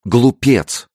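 high, loud burst of indignation; angry exclamation. A narrator's reading. Furious girl to Francis after he tags her shoulder, exclaiming angrily. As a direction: fling the insult out sharply. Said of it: "воскликнула она".

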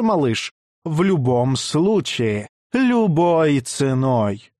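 slow, high showman's call; Knut. Acted. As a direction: announce it like a circus ringmaster. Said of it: "унтер-центурион Кнут".

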